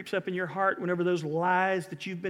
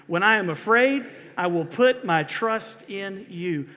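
raised, emphatic recitation with conviction; louder, meaning it; 4 semitones higher, 6 dB louder, slower than usual. Shown as a demonstration. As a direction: lead the congregation louder, like you mean it. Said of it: "Say it louder like you mean it".

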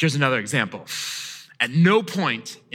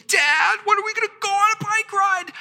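put-on very high, fast, nasal-free kid whine; whining child; faster than usual. Child pestering dad about the bike ride, very high whine. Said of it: "if someone comes up to me and says".

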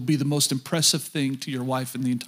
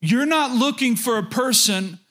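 preaching; 6 semitones higher than usual. Acted. Preaching at full force.

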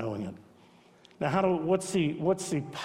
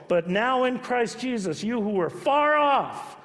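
higher, louder, slow ringing preaching; preaching climax; 8 semitones higher, 4 dB louder, slower than usual. Acted. Preacher building the scripture reading to its peak. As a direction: lift it up and slow down.

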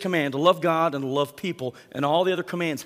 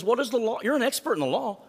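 Jesus pressing the expert, higher; Jesus turning the question back on the expert, pointed and higher; 8 semitones higher than usual. Retold or imitated. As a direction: lift the voice on each question, probing. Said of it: "He says".

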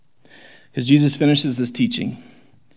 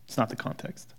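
usual speaking voice

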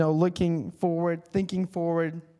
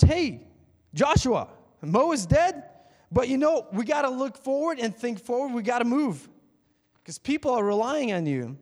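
casual pep-talk God; 6 semitones higher than usual. Acted. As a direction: give Joshua a friendly push, upbeat. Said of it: "God's pretty much".

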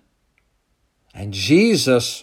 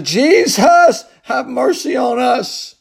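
desperate cry; 10 semitones higher, slower than usual. Retold or imitated. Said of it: "they cried out to Jesus".

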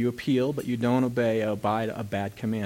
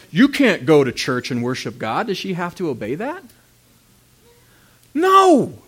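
loud, higher, fiery sermon voice; preaching at full force; 9 semitones higher, 9 dB louder than usual. Acted. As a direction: preach it hard to the congregation.

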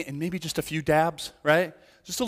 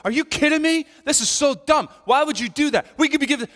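high, loud, incredulous scolding; hammed-up exasperated telling-off; scolding outburst; 11 semitones higher, 6 dB louder than usual. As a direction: act out a crowd lighting into someone. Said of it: "they just lit into her".

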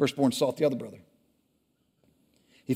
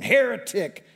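high, loud accusing scream; 8 semitones higher, 5 dB louder, slower than usual. Acted. Path builder screaming at the firstborn. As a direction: spit the word out as a curse. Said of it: "screamed the path builder".

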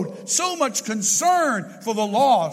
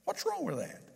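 higher, softer challenging retort; mild mock challenge, pitched up; challenging question; 7 semitones higher, 11 dB quieter than usual. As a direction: toss the question back, a touch defiant. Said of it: "And she said".

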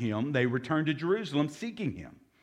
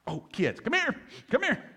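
a much higher, sing-song parent call; calling the kids; 10 semitones higher than usual. Retold or imitated. Parent calling back the kids they forgot, high sing-song.

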